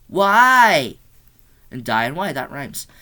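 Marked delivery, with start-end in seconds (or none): 0.1-0.9 raised, drawn-out cry of disbelief; exasperated outcry. Real, not acted. Reviewer baffled by the killer's line, exasperated outcry. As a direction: call it out high and loud.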